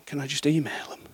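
imitated meek student, half-whispered; timid request. Student dodging a phone call, timid hushed plea. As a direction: ask small and hushed, almost voiceless.

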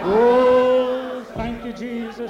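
a sudden loud oh, then thanks; ecstatic praise. Real, not acted. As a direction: cry out in fervent worship. Ecstatic praise after weeping.

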